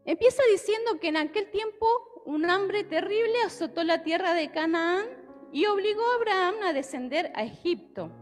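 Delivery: ordinary talk, nothing marked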